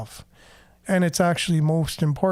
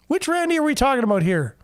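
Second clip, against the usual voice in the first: higher, quick feigned-clueless question; mock-puzzled; 7 semitones higher, faster than usual. Host jokingly asks listeners which Randy is meant, mock-puzzled. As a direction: play dumb, pitched up.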